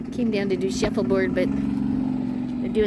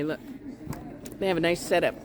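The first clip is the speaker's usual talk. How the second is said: usual speaking voice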